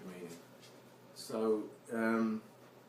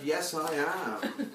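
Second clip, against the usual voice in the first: laughing reply; 6 semitones higher, 3 dB louder, much slower than usual. Retold or imitated. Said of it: "I wrote back and said".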